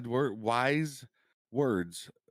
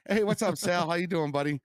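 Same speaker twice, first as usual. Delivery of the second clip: high-pitched, hammy host greeting; entertainment personality voice; 8 semitones higher than usual. Acted. Co-host mimics the YouTube voice he praised, entertainment personality greeting. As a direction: put on an upbeat entertainer's voice. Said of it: "entertainment personality voice".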